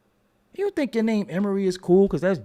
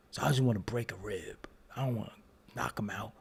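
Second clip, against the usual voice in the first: low hushed threat; menacing whisper; 7 semitones lower, 9 dB quieter, faster than usual. Acted. Threatening a rival dude, whispered. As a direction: whisper it like a quiet promise.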